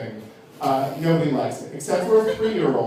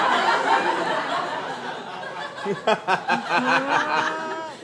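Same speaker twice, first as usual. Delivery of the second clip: high laugh; 8 semitones higher, 5 dB quieter than usual. Real, not acted. Laughs after his impression.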